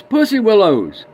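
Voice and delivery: put-on high, loud and slow kid answer. Boy tells the old man his flowers, high kid voice. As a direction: say it proud like a little boy.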